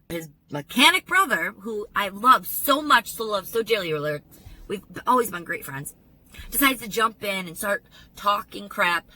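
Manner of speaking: ordinary delivery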